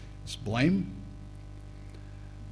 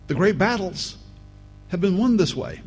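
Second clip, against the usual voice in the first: loud, insistent pulpit push; preacher drives home total commitment, louder; fiery preaching; 7 semitones higher, 5 dB louder than usual. Acted. Preaching emphasis.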